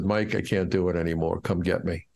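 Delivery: hoarse and worn out, barely managing the words; raspy, weak voice. Retold or imitated. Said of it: "he called me with a raspy voice".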